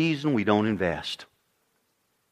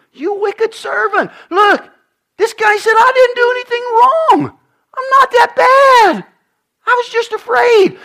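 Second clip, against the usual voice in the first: high, loud, strained cry of the servant; high crying plea, loud; angry king and pleading servant; 9 dB louder than usual. Acted. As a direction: shout the excuse like a cornered servant. Preacher voicing angry king, servant.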